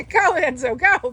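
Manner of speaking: root for the puppy out loud; cheering on. Real, not acted.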